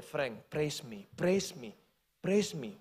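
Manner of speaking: low, soft, slow voice of God; a gentle insistent call, low and hushed. Retold or imitated. God speaking to Frank as he walks out, low and soft. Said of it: "Tuhan tetap ngomong sama dia".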